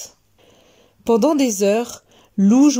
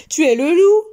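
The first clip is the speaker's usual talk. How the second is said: higher, louder accusation; crying out; 6 semitones higher, 5 dB louder than usual. A narrator's reading. Little girl recognising the wolf, crying out. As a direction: exclaim it as a little girl, pitch jumping up. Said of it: "cria la petite fille".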